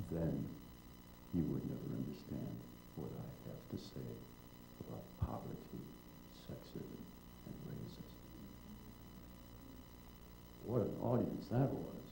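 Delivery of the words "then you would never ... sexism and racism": softer, hushed and calm, nearly breathless by the end; gently disarm them after the storm; a quiet, loving retort fading to almost nothing. Retold or imitated. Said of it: "calmly says in a loving voice".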